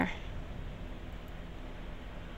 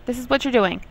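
normal talking voice

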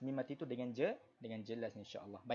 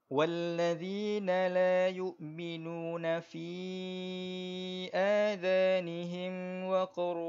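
slow, higher melodic tajwid chant; Quran recitation; 4 semitones higher, 6 dB louder, slower than usual. Shown as a demonstration. Teacher reciting verse for the class, slow melodic tajwid. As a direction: recite for students to follow.

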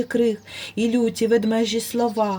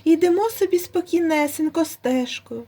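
higher, eager little hare voice; timid hare; 7 semitones higher than usual. A narrator's reading. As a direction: explain yourself nervously to the bear. Hare tells his story.

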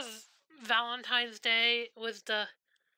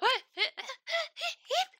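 very high squeaky giggle; squeaky laugh. Retold or imitated. Overwhelmed by a cute gift, squeaky laugh. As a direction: squeak with delight. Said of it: "became a Squeaky Miss Squeakington".

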